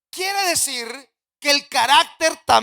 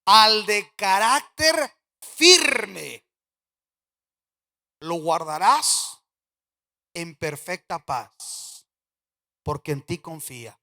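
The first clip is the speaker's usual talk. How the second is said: solemn scripture reading; 5 semitones lower, slower than usual. Real, not acted. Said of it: "en la nueva versión internacional dice". (lectura bíblica solemne, lenta y grave)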